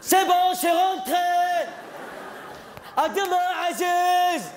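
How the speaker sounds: high-pitched call across the runway; shouting out. Retold or imitated. Father calls out on runway.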